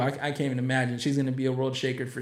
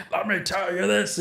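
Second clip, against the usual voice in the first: Bishop Bill Hammond; 4 semitones higher than usual. Retold or imitated. Ninety-year-old bishop preaching to parents, imitated old-man voice. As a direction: mimic an elderly man's crazy way of talking.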